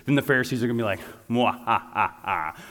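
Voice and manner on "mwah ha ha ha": a lightly hammed evil mwah-ha-ha; mock villain laugh. Pharisees gloating at the trapped teacher, mock evil laugh.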